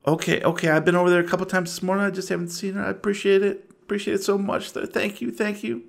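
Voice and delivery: lightly voiced anxious mother, trailing soft; a flustered mother giving thanks; a hint of fretful gratitude; grateful worried mother. Game master voices grateful mother.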